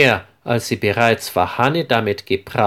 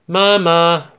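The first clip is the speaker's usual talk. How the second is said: high, loud call through the house; 6 semitones higher, 3 dB louder than usual. A narrator's reading. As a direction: call out for mom as the door opens. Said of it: "ruft Marie laut". (laut rufend, deutlich höher)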